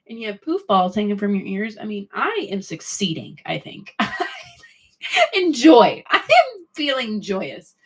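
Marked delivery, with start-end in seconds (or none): 5.3-7.7 high, slow hammed-up joy; playfully over-the-top joy; mock joyous. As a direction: ham up the bliss.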